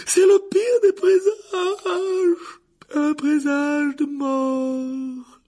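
very high, loud, slow and tearful; wailing seer. Acted. The divination teacher wails the omen of death to Harry. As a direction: wail the death omen with a sob.

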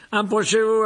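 high, loud, pompous summons; the vain wizard professor beckoning students; grand welcoming call; showman calling the crowd. A narrator's reading. Lockhart addresses the hall.

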